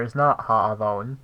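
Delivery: not hit loud and rising; stress on not. Shown as a demonstration. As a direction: punch the word not against Ha alone. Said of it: "as you can hear".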